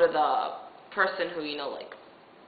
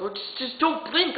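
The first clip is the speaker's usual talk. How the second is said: higher-pitched imitation of mild exasperation; kinda pissy; 7 semitones higher than usual. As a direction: imitate a nurse losing patience. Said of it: "starting to get, get kinda pissy".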